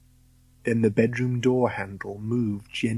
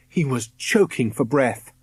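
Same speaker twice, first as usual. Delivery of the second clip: usual speaking voice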